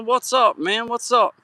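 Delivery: real bright hello; excited greeting. Real, not acted. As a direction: call out happily to a friend. Greeting a viewer in chat.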